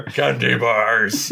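very slow, drawn-out quote; imitated nonsensical blurt, elongated; movie-line imitation. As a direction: stretch both words like a spaced-out stoner. Quoting stoner's slow nonsensical line.

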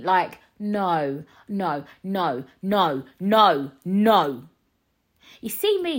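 ordinary delivery

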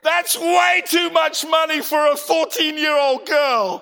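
loud, high indignant outburst; inner outrage. Retold or imitated. Preacher voicing his silent fury at the shop lady. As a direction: let the outrage boil over.

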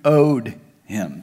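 normal talking voice

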